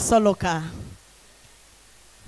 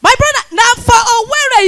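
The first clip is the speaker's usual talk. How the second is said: much higher and louder, hyping the crowd; big loud opener; 9 semitones higher, 8 dB louder than usual. Acted. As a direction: launch it at the room.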